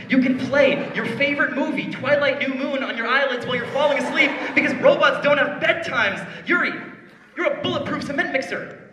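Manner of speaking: high, loud hype speech; hyped. Acted. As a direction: sell the dream with hype. Hyped pep talk.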